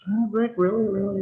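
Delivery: retold disbelief, voice jumping high; mock shocked reply. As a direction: play it as stunned, half-comic disbelief. His own shocked reply retold.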